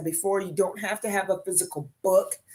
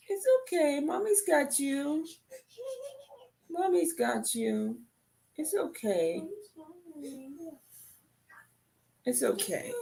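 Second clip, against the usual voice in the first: high, soft reassuring voice; 9 semitones higher, 6 dB quieter than usual. Real, not acted. Comforting hurt grandson.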